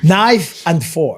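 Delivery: "Knife and fork" drawn out slowly, laughing; slow correction. Retold or imitated. Father correcting his son at the restaurant, very slowly. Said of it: "I was like".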